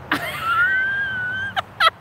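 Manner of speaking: shrill giggle on demand, way up high; high squealing laugh. Shown as a demonstration. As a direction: show off a silly nervous laugh. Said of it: "How's that for a nervous laugh?".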